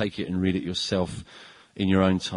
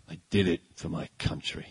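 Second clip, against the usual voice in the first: put-on old-style hero line; 4 semitones lower, 4 dB quieter than usual. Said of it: "Harrison Ford would have said".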